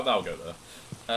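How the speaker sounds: ordinary delivery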